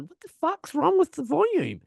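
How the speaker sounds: high-pitched baffled cry; retold bafflement.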